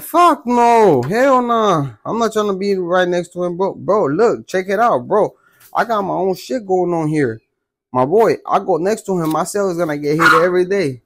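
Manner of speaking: high-pitched protest, starting highest; alarmed refusal. Retold or imitated. Inmate refusing to move next to the old man, alarmed protest. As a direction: re-enact a frantic objection to the move.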